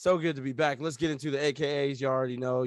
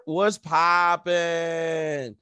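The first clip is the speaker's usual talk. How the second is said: grand entrance announcement; 4 semitones higher, 6 dB louder, much slower than usual. Acted. Podcast host opening hype.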